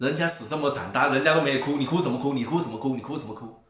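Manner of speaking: scolding. Real, not acted.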